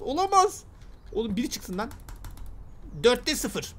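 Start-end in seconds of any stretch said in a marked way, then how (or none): 0.0-0.6 much higher disbelieving olamaz; high dismayed cry. Real, not acted. Gamer watching the key fail, high dismayed cry.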